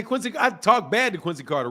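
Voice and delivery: usual speaking voice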